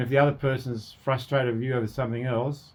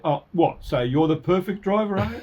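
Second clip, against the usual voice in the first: higher, louder sarcastic comeback; contemptuous; 7 semitones higher, 5 dB louder than usual. Acted. Person answering criticism of their driving, acted contemptuous comeback. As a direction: throw it back with mock contempt. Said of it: "with that contemptuous voice".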